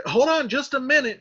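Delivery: high, loud call toward the door. Retold or imitated. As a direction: shout it out to whoever is knocking. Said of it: "talking back and forth to the door".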